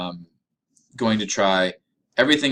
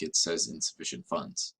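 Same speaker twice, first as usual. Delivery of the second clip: softer and lower, half-murmured as the cashier; retold under-her-breath remark; 5 semitones lower, 9 dB quieter than usual. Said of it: "under her breath".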